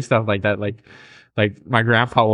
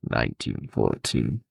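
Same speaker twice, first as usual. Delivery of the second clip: low, slow, quiet voice; low, soft put-on mobster voice; mock old-time gangster; 11 semitones lower, 7 dB quieter, slower than usual. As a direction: drop it deep and slow. Puts on old British mobster voice.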